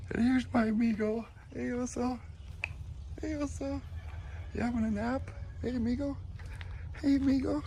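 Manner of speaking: very high cooing pet voice; talking to the dog. Real, not acted. Greeting his sleepy dog Oso in a very high coo.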